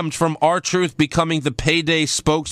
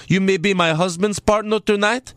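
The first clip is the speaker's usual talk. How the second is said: imitating a thick, Borat-like accent; Lana's accent; 3 semitones higher than usual. Host mimicking Lana warning off Charlotte, put-on Borat-like accent. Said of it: "That's how she talks".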